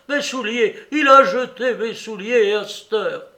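put-on high, loud old man's outrage; outraged cry. Old Soupe cries out at Larrier, outraged. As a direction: wail it in disbelief. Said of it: "rugit le père Soupe".

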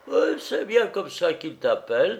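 high, amused cook's voice; laughing cook. A narrator's reading. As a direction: tease the valet in a high voice. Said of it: "La rieuse cuisinière".

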